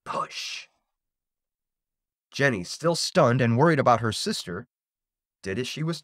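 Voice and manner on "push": soft, voiceless ghostly whisper; eerie whisper. A narrator's reading. Unseen voice instructs Jenny in the darkness, eerie whisper. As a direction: whisper it eerily, all air. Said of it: "She heard an eerie whisper".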